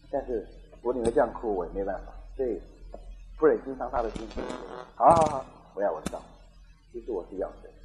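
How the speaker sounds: soft, low retold confession; tearful resignation. Wife confiding on the phone, soft, understated sadness. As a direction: let it sink quietly, only a touch of sorrow. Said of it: "电话里面就哭".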